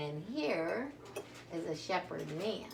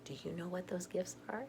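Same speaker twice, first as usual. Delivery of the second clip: usual speaking voice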